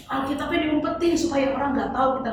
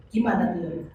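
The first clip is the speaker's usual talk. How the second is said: ordinary delivery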